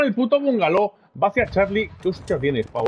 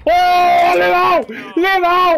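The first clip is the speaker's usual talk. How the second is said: yelling in triumph, higher and louder; excited shout; 9 semitones higher, 5 dB louder, slower than usual. Real, not acted. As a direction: shout it out, thrilled. Celebrating a hit in game.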